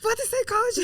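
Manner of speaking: high, loud mock dad voice, incredulous; hammed-up Soviet dad scoffing; imitating strict dad. Daughter mocks her father's reaction to psychology. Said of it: "my dad was like".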